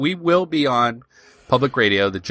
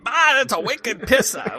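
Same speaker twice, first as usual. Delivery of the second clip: high-pitched, hammed-up Boston accent; badly faked Boston accent through laughter; 12 semitones higher than usual. Co-host mimicking Boston for radio callers, hammed-up Boston accent. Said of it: "in our best Boston accent".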